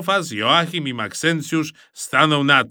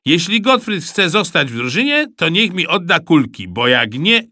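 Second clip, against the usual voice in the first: raised, higher indignant voice; shouted demand; 6 semitones higher, 6 dB louder than usual. A narrator's reading. Eusebiusz demands his marbles back, shouting. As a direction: loudly lay down a condition. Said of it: "krzyknął Eusebiusz".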